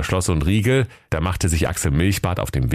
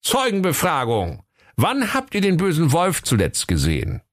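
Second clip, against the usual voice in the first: high, official interrogation call; raised-pitch storybook cop; inspector's announcement; 11 semitones higher than usual. A narrator's reading. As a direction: announce it like a comic policeman. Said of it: "Axel Milchbart und rief".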